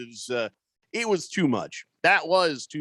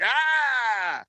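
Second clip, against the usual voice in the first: mock yelp of pain; 7 semitones higher than usual. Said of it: "And you're like".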